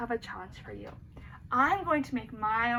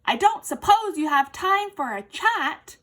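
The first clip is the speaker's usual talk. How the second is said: friendly elephant; 3 semitones higher, 9 dB louder than usual. A narrator's reading.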